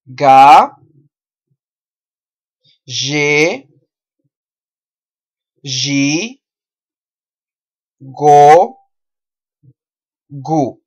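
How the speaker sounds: "Ga, ge, gi, go, gu", each held, with long pauses; repeat-after-me drill. Shown as a demonstration. Teacher speaks first, learners repeat, syllable drill. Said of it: "Voy a hablar primero y usted habla después".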